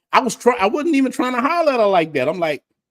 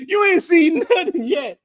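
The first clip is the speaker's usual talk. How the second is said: laughing; 9 semitones higher than usual. Real, not acted. Teasing warning to friend.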